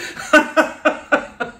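breathy trailing laugh; airy laugh. Real, not acted. Vlogger laughing at her credits joke, airy laugh.

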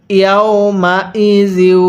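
slow, connected Quran syllables; measured teaching recitation; model recitation. Shown as a demonstration. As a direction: show how it reads, stretched out. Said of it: "পড়ুন".

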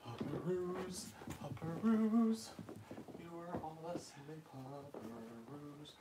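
high, drawn-out sing-song coo to the pups; high slow puppy coo. Real, not acted. Woman coos high and slow at her litter of puppies. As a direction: stretch it high and slow like cooing to babies.

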